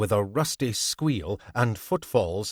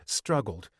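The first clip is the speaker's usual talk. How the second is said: ordinary delivery, nothing marked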